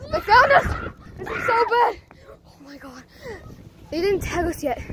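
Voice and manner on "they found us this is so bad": high frantic shout; panicked yell. Real, not acted. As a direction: yell it in alarm. Spotted during hide and seek.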